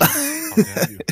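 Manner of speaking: high, loud, slow hammed-up indignation; mock outrage. Joking to friend about Linux, mock outraged and loud. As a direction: play the offended zealot, drawn out.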